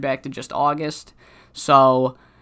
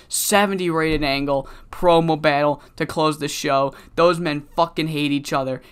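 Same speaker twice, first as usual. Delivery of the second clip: ordinary talk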